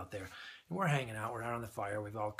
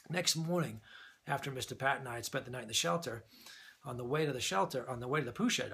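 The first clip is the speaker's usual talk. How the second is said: ordinary talk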